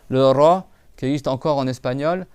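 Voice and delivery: usual speaking voice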